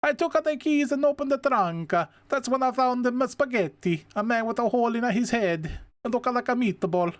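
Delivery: high, hammed-up Italian accent; heavy Italian accent. Officer Gillespie describing the body in the trunk, in a heavy Italian accent. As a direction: play the cop as a thick Italian accent. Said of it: "It is heavy Italian accent".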